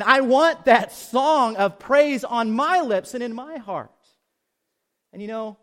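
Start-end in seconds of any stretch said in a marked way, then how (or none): 0.0-3.9 fervent prayer. Acted. David's prayer voiced in sermon.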